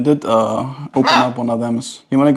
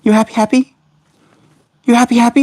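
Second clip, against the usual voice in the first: high, perky rising questions; 10 semitones higher, faster than usual. Real, not acted. Streamer asking pet bird if it's happy, high baby talk.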